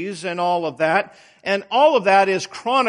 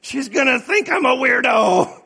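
mock-panicked shout at oneself; 4 semitones higher than usual. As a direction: replay the horror of the misdirected text. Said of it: "and I go, I go".

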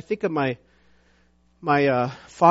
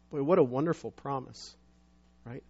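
ordinary talk, nothing marked

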